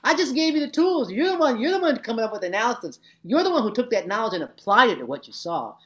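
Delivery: high, loud animated denial; hammed-up protest of innocence; playful retort. Teacher answering students who blame her, high and loud.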